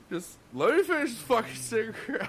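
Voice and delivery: high, strained plea, half laughing; pleading for a minute. Retold or imitated. Cook begging the manager for a minute, high and strained. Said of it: "I'm like".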